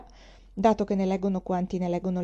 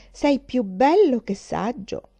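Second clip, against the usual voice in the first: slow, louder, higher teasing put-down; teasing jab; 4 semitones higher, 6 dB louder, slower than usual. Acted. Sister mocking Henry, slow and louder. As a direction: tease him slowly and pointedly.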